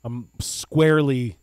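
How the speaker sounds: normal talking voice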